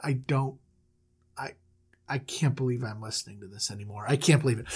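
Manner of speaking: low, quiet, halting exasperation; stunned disbelief. Acted. Outraged brothers who can't believe they're listening, low and weary. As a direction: say it under your voice, at your limit. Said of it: "The brothers are outraged".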